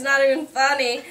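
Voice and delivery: ordinary talk, nothing marked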